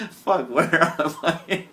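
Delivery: slow words squeezed through laughter; laughing, slow. Real, not acted. Lost at night, slowly laughing it out. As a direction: giggle out the question.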